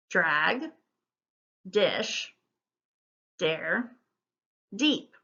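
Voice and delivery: one word at a time with long pauses; isolated words, unhurried and evenly spaced; careful word modelling. Shown as a demonstration. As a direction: model each word separately for learners. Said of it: "I'll go through it once".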